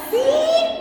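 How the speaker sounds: triumphant burst of joy; excited laugh. Real, not acted. Preacher to congregation after a testimony, laughing excitedly.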